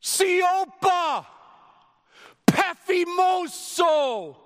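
high, loud, slow rebuke; Jesus rebuking the wind with a slow shout; commanding shout. Retold or imitated. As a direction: order the wind to be silent. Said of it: "he speaks with all seriousness".

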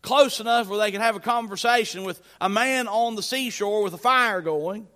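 normal talking voice

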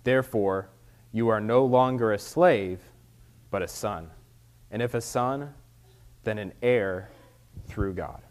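loud, formal scripture reading. Acted. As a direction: read it out to the congregation. Reads scripture aloud.

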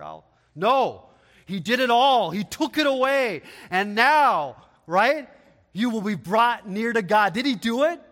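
loud, rising preacher's cry; building fervent sermon voice; full-force preaching. Acted. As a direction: preach it out to the congregation.

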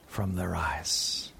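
soft, slow and low; hushed, drawn out comfort; tender. Acted. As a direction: let the promise fall gently. Tender close of Revelation reading.

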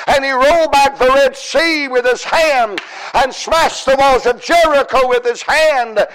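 driving, rhythmic sermon cadence; full-force preaching. Real, not acted. Preacher recounting the mighty hand of God, driving full-force preaching. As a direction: hammer each repeated ending with building conviction.

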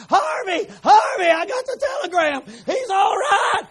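high crying shout of joy; joyful shouting. Retold or imitated. Mother calls to husband, crying out with joy. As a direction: shout it through happy tears. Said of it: "She got to shouting".

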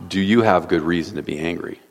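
calm the angry prophet down softly; gentle reply. Acted. God gently answers angry Jonah, soft and calm. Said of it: "God gently responds".

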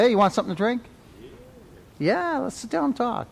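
a friendly host calling out a welcome. Retold or imitated. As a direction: welcome the guest into the house. Voicing friendly hosts at the house.

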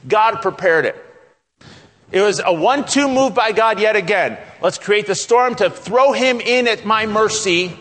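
high, loud, emphatic sermon delivery; full-force preaching. Real, not acted. Preacher insisting God prepared the great fish, full-force preaching. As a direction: drive each phrase home from the pulpit.

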